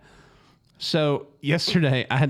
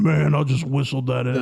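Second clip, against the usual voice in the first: weird voice. Retold or imitated. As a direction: say it oddly, hissing the S. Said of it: "said it all weird".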